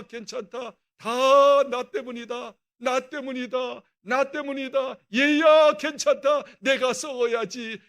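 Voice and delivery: from tender to loud, slow preaching cry; building impassioned plea. Acted. Pastor pleading to his flock, slow and rising to a loud cry. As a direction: pour out love to the congregation, swelling.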